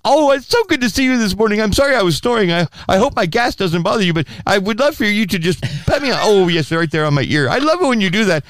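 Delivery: high, gushing doggy voice; happy dog voice. Acted. The dog greeting its owner in the morning, high gushing. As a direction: gush like an adoring pet. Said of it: "the dog says".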